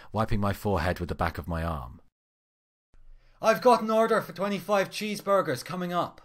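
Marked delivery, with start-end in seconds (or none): 3.4-6.2 high, loud yell from the order taker. Acted. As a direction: call it out over the headset. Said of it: "one of the order takers in the drive-through yelled".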